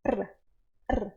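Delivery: R, r as brief taps on the palate; r tapped briefly; short tapped r. Shown as a demonstration. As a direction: tap the r quickly, three to five beats. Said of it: "Batendo de três a cinco vezes".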